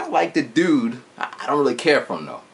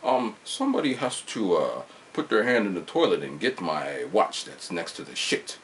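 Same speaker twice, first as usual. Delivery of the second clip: put-on masked villain voice, dropping softer; mock villain boss; 4 semitones lower, 5 dB quieter than usual. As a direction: order a minion as a mock villain. Playing boss giving team an order.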